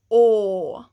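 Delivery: loud, open-mouthed "o"; open vowel. Shown as a demonstration. Teacher projecting the third vowel to class. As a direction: open wide on "o". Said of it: "the sounds come from the middle".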